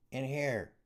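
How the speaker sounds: a sudden high, thin call; high-pitched voice. A narrator's reading. Guard calling to another through the cell door, high-pitched. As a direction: pitch it well up, hissed urgently. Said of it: "came a high-pitched voice".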